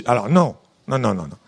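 ordinary talk

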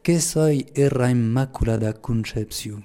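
slow, measured Lourdes patois; Occitan patois. Shown as a demonstration. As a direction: recite it slowly in Pyrenean Occitan dialect. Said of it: "je vais le dire en patois".

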